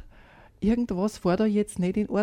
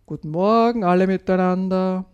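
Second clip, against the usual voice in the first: louder cheerful morning call through the door; sleepy dad calling good morning, louder; 4 dB louder than usual. A narrator's reading. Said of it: "bleibt er kurz stehen und ruft".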